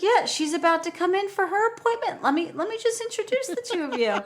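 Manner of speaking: very high, bubbly put-on voice; mock gushing therapist. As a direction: ham up the overexcited therapist. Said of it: "And she's like".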